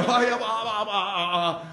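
hammed-up nervous babble, softening; tongue-tied stammer. Nervous cadet answering the taunting instructor, tongue-tied. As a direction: stammer helplessly, mock panic.

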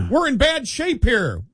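high-pitched mock plea; mock screaming. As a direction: plead in hammed-up distress. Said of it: "they're screaming".

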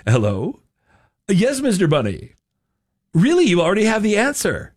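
mock astonishment in an announcer's swooping voice; game show announcer. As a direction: imitate a cartoon quizmaster taking a call. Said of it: "he answers the phone and he says".

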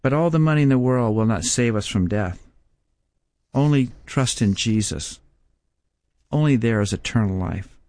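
ordinary talk, nothing marked

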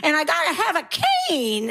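put-on high, wailing caricature of an old lady; old lady impression. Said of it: "they perform an old lady".

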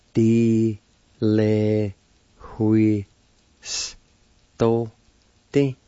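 each syllable of "Di le hui sto te" held long and separate. Shown as a demonstration. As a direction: sound out "Di le hui sto te" slowly, pausing between syllables. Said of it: "It's pronounced like this".

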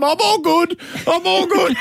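high, laughing "I'm all good" after a stumble; laughing brush-off. Retold or imitated. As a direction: shrug off the trip with an embarrassed laugh. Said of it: "And I'm going".

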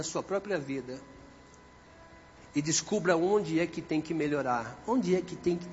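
soft, low, sober exhortation; hushed pastoral appeal. Real, not acted. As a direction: bring the congregation down into quiet reflection. Hushed appeal for self-examination.

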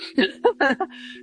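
short, uneasy laugh; nervous laugh. Real, not acted. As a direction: laugh off the embarrassment. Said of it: "there's a little nervous laughter there".

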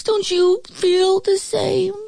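high, slow appeal; wolf calling out. A narrator's reading. Wolf appeals to the pigs, slow high call. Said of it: "he called to the pigs".

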